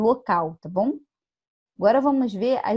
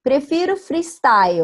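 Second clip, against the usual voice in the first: skater slang; 5 semitones higher, 5 dB louder than usual. Retold or imitated. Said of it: "Por exemplo".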